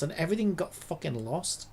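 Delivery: ordinary talk, nothing marked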